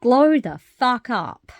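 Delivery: quoting her mentor with a slow, loud, high rallying cry; mentor's rallying cry. Retold or imitated. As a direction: belt it like a motivational slogan. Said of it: "As Kim, one of my other mentors, says".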